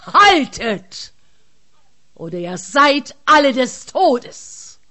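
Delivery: booming giant bellowing a threat; terrifying giant shout. Acted. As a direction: roar it out like a fearsome giant. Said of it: "mit einer eigens furchterregenden Stimme".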